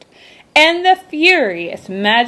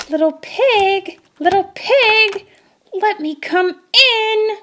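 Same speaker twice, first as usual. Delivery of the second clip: high, slow shark calling in rising annoyance; growing upset; 12 semitones higher than usual. A narrator's reading. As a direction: call higher and slower, losing patience. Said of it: "growing upset".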